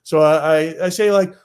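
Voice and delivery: ordinary delivery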